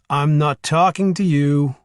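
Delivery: slow teasing sing-song lilt. A narrator's reading. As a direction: tease her in a slow singsong. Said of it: "he said in a sing-song voice".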